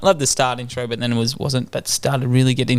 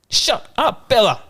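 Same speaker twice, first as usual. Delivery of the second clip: put-on toddler yelling; 8 semitones higher, slower than usual. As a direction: shout it bossily like a little kid. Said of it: "I'm like".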